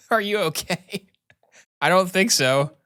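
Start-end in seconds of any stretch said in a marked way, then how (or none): 0.0-1.0 high, slow question through a laugh; giggling. Acted. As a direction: ask it while breaking up laughing.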